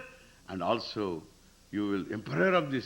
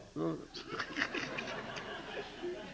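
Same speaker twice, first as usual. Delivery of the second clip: breathy laugh; 11 dB quieter than usual. Real, not acted. Long laugh after the mockery.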